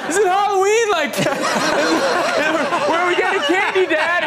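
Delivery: put-on high, loud, half-laughing child's call; confused kid calling out. Kid stuck in a house costume asks dad, high bewildered call.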